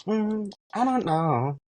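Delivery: high, slow, airy mystery; coy and drawn out; mysterious drag queen voice. Retold or imitated. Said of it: "she's like".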